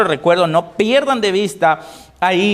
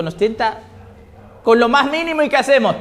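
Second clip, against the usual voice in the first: ordinary talk, nothing marked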